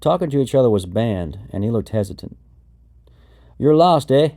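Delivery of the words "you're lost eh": put-on Wisconsin accent, raised and louder; thick Wisconsin accent. A narrator's reading. Big airman from Wisconsin to lost trainee, thick Wisconsin accent. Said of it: "he said in a thick accent".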